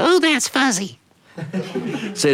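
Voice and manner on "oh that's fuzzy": put-on much higher small boy's voice; a little kid marvelling at his fuzzy head; little brother voice. Little brother feeling his cut hair, high childlike wonder.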